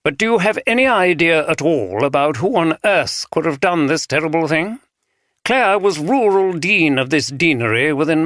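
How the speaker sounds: every word precise in RP; refined RP accent. A narrator's reading. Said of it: "speaking very precisely in a refined R P accent".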